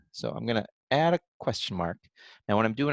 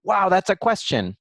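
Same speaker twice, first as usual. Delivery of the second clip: bright high, louder exclamation of wonder; wowed; 9 semitones higher, 5 dB louder than usual. Acted. Teacher acting out her own thought to young writers, wowed. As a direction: react with amazed interest to your own thought. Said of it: "And then I thought".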